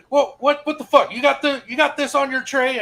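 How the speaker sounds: high, loud berating TI imitation; mock TI yell. Recruit imitates his TI berating him over his tray, loud and high. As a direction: chew him out like a drill instructor. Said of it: "He's like".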